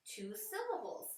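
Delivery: normal talking voice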